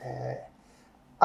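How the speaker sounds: usual speaking voice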